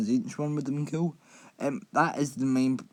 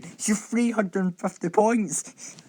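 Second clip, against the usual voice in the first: incredulous; 10 semitones higher, slower than usual. Real, not acted. Incredulous about the points cost.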